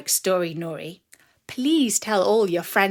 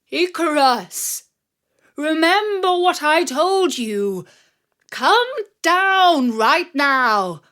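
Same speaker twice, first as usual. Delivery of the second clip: higher, louder, slow urgent call; calling up urgently; 7 semitones higher, 7 dB louder, slower than usual. A narrator's reading. Father calls up to his son flying too high, loud and urgent. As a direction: shout up to him, stretching each word. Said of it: "His father called up to him".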